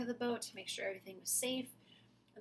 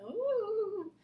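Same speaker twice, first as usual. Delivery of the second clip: ghost ooh; 11 semitones higher, 6 dB louder than usual. Acted. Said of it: "the ghosts put up their little ghost thumbs and said".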